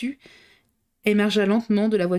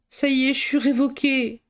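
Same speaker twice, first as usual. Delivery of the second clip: despairing tone; 6 semitones higher than usual. A narrator's reading. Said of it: "d'un ton désespéré".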